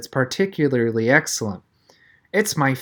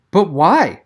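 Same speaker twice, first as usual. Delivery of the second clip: louder, pleading why; rising voice; 3 semitones higher, 5 dB louder than usual. A narrator's reading. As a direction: voice climbing in disbelief. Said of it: "His voice was rising".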